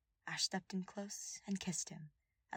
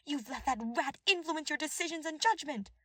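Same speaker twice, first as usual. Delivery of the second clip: high, louder furious accusation; ranting; 10 semitones higher, 6 dB louder than usual. A narrator's reading. Cornelia accusing the king of being misled, angry. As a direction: spit the blame at the king.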